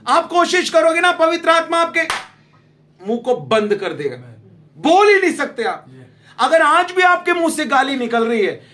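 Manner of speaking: loud, high, forceful preaching; fiery preaching. Acted. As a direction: drive the point home to the congregation.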